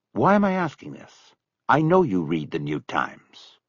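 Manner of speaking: plain reading voice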